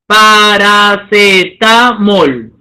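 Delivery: Paracetamol stretched slowly, syllable by syllable; pronunciación lenta por sílabas. Shown as a demonstration. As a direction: drag it out to find the stress. Teacher models the word slowly.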